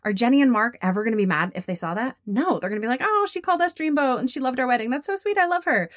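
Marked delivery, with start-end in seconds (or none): 3.0-5.9 high, delighted gushing voice; mock-swooning in a high pitch; gushing clients. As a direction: coo it sweetly high, imitating thrilled clients. Imagined delighted clients reacting.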